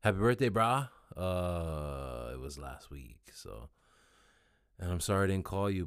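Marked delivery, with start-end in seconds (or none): 0.0-0.9 loud, upbeat birthday greeting; louder casual shout-out. Shown as a demonstration. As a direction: shout it out to your brother. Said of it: "but I should have said".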